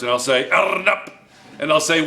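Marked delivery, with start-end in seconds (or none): 0.5-1.1 higher "Arnab" with a rolled r; "Arnab" pitched up, r held long; Arabic pronunciation. Shown as a demonstration. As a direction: try "Arnab" in Arabic, trilling the r. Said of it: "Arabic you learned in two years".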